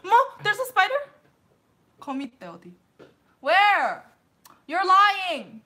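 loud high panicked cries; screaming at a spider. Real, not acted. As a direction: shriek at a spider scare. Scared by spider claim.

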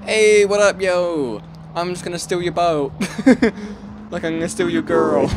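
lightly put-on American dude voice, high; mock American accent. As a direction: swagger as a cocky American thief. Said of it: "Why am I talking in an American accent?".

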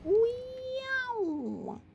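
high, soft playful meow; cat meow. Pretend. Streamer playfully meowing at game. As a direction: mew like a little cat. Cute noise while playing.

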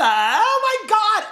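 high, loud, slow shriek of delight; excited shriek. Real, not acted. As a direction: squeal it out again in joy. Still thrilled about the gift.

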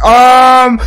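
hammed-up sheepish monster; fibbing monster. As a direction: stretch out a silly evasive answer. Monster fibs about brother.

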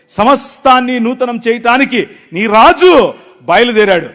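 loud, solemn proclamation; proclaiming God's words. Acted. God answering the prophet, loud commanding proclamation. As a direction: announce it like a divine decree.

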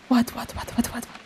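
whispered, rapid-fire repeats; spooked whisper. Real, not acted. Player startled mid-sentence by the game, spooked whisper. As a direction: break off into a fading whisper.

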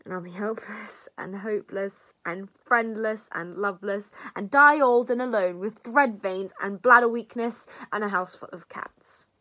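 building, breathless list that peaks very loud; despairing rant. Acted. Friend foresees dying old and alone, melodramatic despair. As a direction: wail out the list of miseries, then tail off.

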